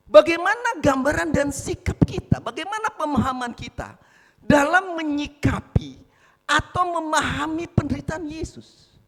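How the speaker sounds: loud, ringing sermon delivery; full-force preaching. Acted. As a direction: preach it out to the congregation. Preacher's loud opening exhortation.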